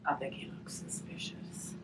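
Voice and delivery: conspiratorial whisper. Acted. Teacher musing about the suspicious onion, whispered. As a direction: lean in and whisper it.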